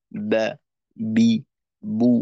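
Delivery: বা বি বু pressed firmly at the lips; firm lip pressure on বা, বি, বু; letter sounds demo. Shown as a demonstration. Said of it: "এই ধরনের উচ্চারণ হবে।". (ঠোঁটে জোর দিয়ে বা বি বু)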